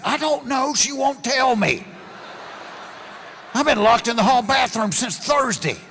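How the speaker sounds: high, loud pleading; desperate locked-in friend. Retold or imitated. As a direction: beg through the door like he's trapped. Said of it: "He said".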